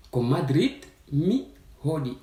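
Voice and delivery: ordinary delivery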